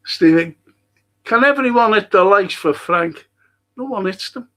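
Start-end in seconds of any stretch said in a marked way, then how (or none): 0.0-3.3 loud, raised appeal to the chat; calling out to viewers. Real, not acted. Host calls on viewers for likes, loud. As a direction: shout it out so the whole stream hears.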